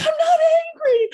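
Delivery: high, loud, half-crying mock shout of denial; the protest now cracking into a laughing wail; escalated mock angry yell. Recalling insisting to her friend she isn't angry, high, loud, half-crying mock shout. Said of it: "Sounds like the response of an angry person".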